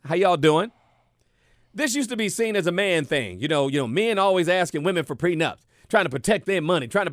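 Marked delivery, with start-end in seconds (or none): none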